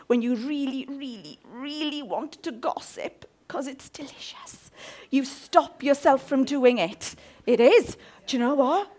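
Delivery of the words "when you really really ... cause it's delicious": conspiratorial whisper. Acted. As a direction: lean in and whisper it like gossip. Gossip confided as a secret.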